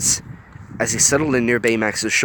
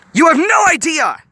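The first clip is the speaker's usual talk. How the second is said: furious yell; 8 dB louder than usual. A narrator's reading. Honey shoving Gogo, yelling.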